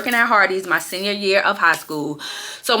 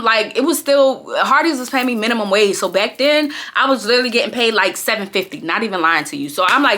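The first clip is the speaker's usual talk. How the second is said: normal talking voice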